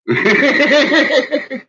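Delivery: loud high laughing. Real, not acted. Boasting about how he fights, loud high laugh. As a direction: burst out laughing.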